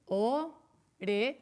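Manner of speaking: "Orejas" slowly in three beats, O, re, jas; split into syllables. Shown as a demonstration. Teacher showing a three-syllable word, "Orejas" syllable by syllable. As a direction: pause between each syllable of "Orejas". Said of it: "cuáles son de tres sílabas".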